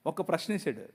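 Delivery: soft, low tender rebuke; God speaking gently. Retold or imitated. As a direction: gently ask a father's question. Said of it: "ఆయన అన్నాడు". (మెల్లగా ప్రేమగా గద్దిస్తూ)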